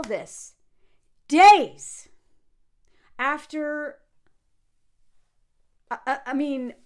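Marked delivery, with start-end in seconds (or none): 1.3-4.0 DAYS burst out very loud and high; real outraged shout on "days"; outraged emphasis. Real, not acted. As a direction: hit "days" with a loud, indignant punch. Outraged at timing of monologue.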